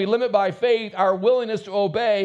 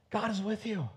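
soft reassurance; 11 dB quieter than usual. Acted. Pastor softens the key line.

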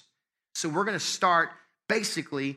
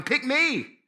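higher-pitched plea to be picked; eager volunteering; 7 semitones higher, 3 dB louder, much slower than usual. Acted. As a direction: call out keenly, first in line. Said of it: "I should be first in line going".

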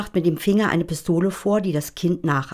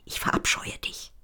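voiceless whisper, much softer; hiss-free soft whisper, no voice; 7 dB quieter than usual. A narrator's reading. Gitti whispers the line for the child.

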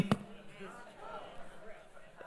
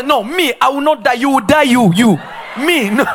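louder, higher 'not me' retort; defiant mock shout; 4 semitones higher, 5 dB louder than usual. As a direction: refuse and shove it back, laughing. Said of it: "Then they will say".

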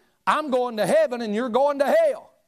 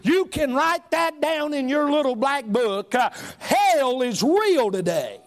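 fiery sermon shout; preaching shout; 5 semitones higher than usual. Acted. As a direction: hammer it home from the pulpit. Preaching at full force.

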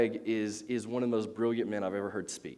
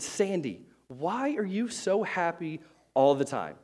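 high, drawn-out mock exasperation; particularly annoyed; 6 semitones higher, slower than usual. Annoyed boy to the happy girl in class, exasperated. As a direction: stretch the question out, annoyed. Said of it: "he was particularly annoyed".

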